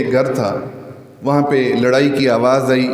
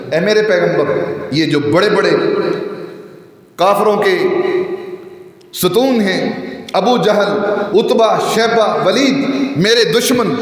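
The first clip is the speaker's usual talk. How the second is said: fiery pulpit delivery; impassioned preaching; 6 semitones higher than usual. Acted. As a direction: preach with rising passion.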